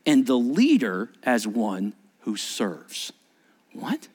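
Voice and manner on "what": mock whispered what. Mock question from a listener.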